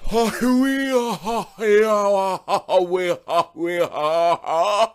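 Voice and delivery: hammed-up howling laugh-sing; a mock singing wail. Mock song wailing.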